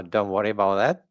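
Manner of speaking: usual speaking voice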